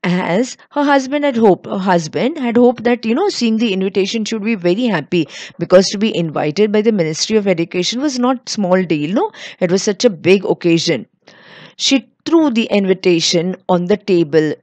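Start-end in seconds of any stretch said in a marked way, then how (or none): none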